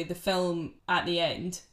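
ordinary talk

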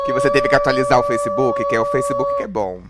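mock crying. Friend joking about heartbreak and Facebook, fake crying whine. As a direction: wail it like a hammed-up heartbreak.

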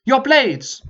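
high, sharp officer's order; barked command. Acted. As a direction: snap the order at the troops. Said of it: "he gave a command".

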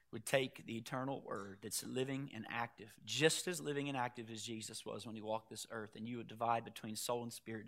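soft, low-pitched praying voice; prayer. Real, not acted. Preacher praying for the congregation before the message, hushed and low. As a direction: pray quietly and evenly, well below preaching level.

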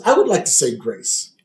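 slow, solemn mock prayer; Lisa. Lisa saying grace for family souls, slow solemn prayer. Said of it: "And Lisa says".

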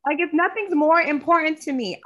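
high-pitched outraged retort. Retold or imitated. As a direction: shoot up in outraged disbelief. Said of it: "And I'm like".

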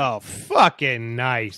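slow drawn-out mock catchphrase; lightly voiced friend's catchphrase; imitating Jim's catchphrase. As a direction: drag it out like his friend always did. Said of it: "Jim Duddy used to always say fucking nice".

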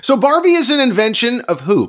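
high loud quiz host; game show host. Pretend. Quiz-host style question.

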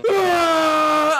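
high shrill scream at the ride's end. Acted. Unicorn screaming high as the tunnel ride ends.

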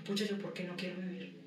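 musing softly to herself; quiet self-question. Retold or imitated. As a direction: ask yourself quietly.

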